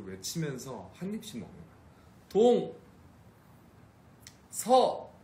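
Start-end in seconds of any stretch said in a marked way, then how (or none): 2.3-5.1 high, loud shouts of each direction; shouting the directions. Shown as a demonstration. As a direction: yell each word out like a cheer. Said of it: "이렇게".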